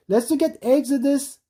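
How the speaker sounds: ordinary delivery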